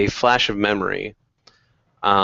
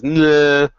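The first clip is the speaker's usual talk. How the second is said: tongue-out jeer, louder and higher; goblin taunt; 5 semitones higher, 5 dB louder than usual. Acted. Goblin sticks his tongue out at the captive, taunting. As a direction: blow it off with a childish bleat. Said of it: "sticks his tongue out".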